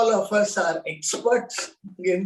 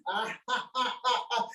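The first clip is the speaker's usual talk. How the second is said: laughing; 9 dB quieter than usual. Shown as a demonstration. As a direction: open hearty laugh. Laughter yoga group laugh.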